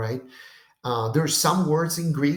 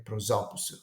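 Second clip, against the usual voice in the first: "Prosoposu" lower and softer, final su unstressed; one joined word "Prosoposu", stress on "so" only; su without accent; 4 semitones lower, 6 dB quieter, faster than usual. Shown as a demonstration. Teacher repeating the joined word, su unaccented. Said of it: "the accent of Sue is simply lost".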